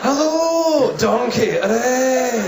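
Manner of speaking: high, drawn-out Scottish ogre call; hammed-up Scottish cartoon cheer; Shrek. Ogre greeting his donkey, silly Scottish call. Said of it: "it sounds like Shrek shagging Billy Connolly".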